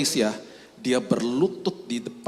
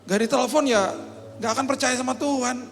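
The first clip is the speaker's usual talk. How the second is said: anxious doubt; 12 semitones higher than usual. Acted. Believing student, anxious after the atheist's dare. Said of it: "ragu-ragu jadinya".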